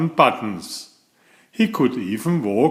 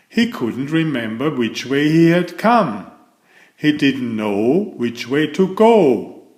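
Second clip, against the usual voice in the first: plain reading voice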